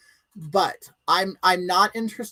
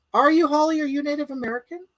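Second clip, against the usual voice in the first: usual speaking voice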